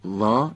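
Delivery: وا said light and forward, open vowel; thin, fronted وا; front-of-mouth sound. Shown as a demonstration. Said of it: "این یعنی از جلوی دهان گفتن".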